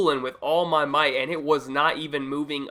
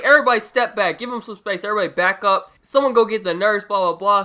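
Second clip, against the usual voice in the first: louder, higher commanding call to the class; teacher shouting orders; 4 semitones higher, 6 dB louder than usual. Retold or imitated. Teacher clears the class away from fallen student, shouting. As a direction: bark it out like a teacher clearing a crowd.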